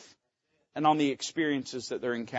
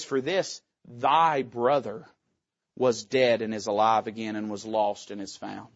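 gentle father; 6 semitones lower than usual. Shown as a demonstration. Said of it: "notice how the father answers".

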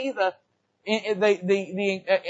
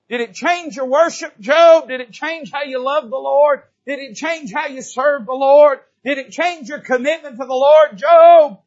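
loud, high, rising preacher's calls; full-force preaching; 7 semitones higher, 10 dB louder than usual. Acted. Preacher crying out to Job in full force. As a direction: hammer each question at the congregation.

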